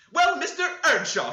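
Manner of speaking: very high, loud scolding; housewife crying out. A narrator's reading. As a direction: scold the young man loudly. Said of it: "she cried".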